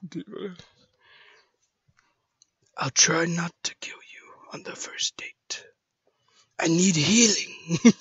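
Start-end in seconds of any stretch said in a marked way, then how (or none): 2.8-5.6 breath-only menacing whisper; hushed, softer character line. Acted. Voice acting an Overwatch character line. 6.6-7.9 slow, put-on game-character call; slow impression of a hero calling for help; Genji. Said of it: "that's a thing with Genji".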